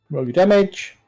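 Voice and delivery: ordinary talk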